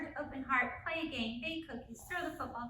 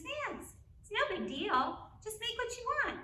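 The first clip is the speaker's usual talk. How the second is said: high, dismissive mock husband voice; imitating husband; 8 semitones higher than usual. Husband dismissing his upset wife, mock high voice. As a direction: brush her off in a high voice. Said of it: "he goes".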